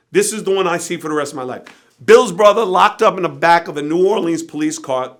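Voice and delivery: ordinary delivery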